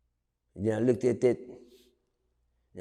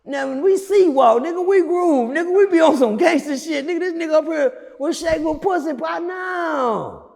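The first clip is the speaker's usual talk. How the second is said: loud rant; 11 semitones higher, 4 dB louder than usual. Retold or imitated. Continued retold rant.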